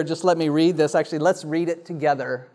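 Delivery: usual speaking voice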